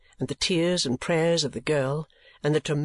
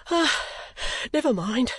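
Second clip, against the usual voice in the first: put-on high, panting old man's voice; out of breath; 10 semitones higher than usual. A narrator's reading. Newman catching his breath after the flight, panting. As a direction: gasp it out after running. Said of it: "gasping for breath".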